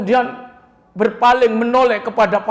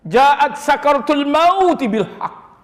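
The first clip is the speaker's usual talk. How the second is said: slow, drawn Arabic verse; slow Quran recitation; 2 semitones higher, 4 dB louder, slower than usual. Acted. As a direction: chant the verse slowly.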